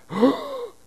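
high sharp gasp of shock. Acted. Preacher playing the shocked crowd, high gasp. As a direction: gasp like a stunned onlooker. Crowd reacts to dead rising.

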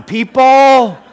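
loud, high, drawn-out appeal; playful exasperated call. Pretend. Preacher urging the congregation to shake their heads, exasperated call. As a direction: call out to a crowd that won't play along.

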